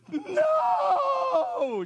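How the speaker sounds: put-on very high, loud kid scream of no; kid shout. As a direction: shriek it like a panicked boy. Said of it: "I was like".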